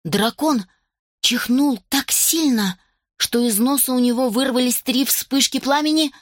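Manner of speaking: ordinary delivery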